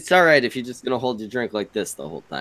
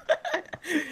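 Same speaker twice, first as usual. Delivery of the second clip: high laugh; 11 dB quieter than usual. Real, not acted. Howling laugh at the joke.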